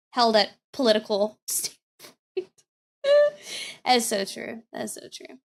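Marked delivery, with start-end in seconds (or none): 4.7-5.4 a soft whispered echo of the words. Real, not acted. Streamer agreeing again with a comment from chat, whispered. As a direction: whisper it back as an aside.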